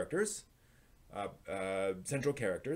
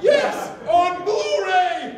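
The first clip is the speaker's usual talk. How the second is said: much higher and louder, laughing, slow on Blu-ray; laughing excitement; 12 dB louder, much slower than usual. Real, not acted. Cheering to co-hosts that it came out on Blu-ray, laughing loudly. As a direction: crow it out through laughter.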